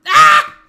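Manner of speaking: piercing high screech; high scream. Real, not acted. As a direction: shriek at the top of your voice. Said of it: "hearing me screech a lot more".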